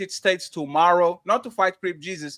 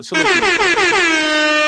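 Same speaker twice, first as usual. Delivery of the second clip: drawn-out salute; 9 semitones higher, 8 dB louder, much slower than usual. Acted. Drawn-out shout-out.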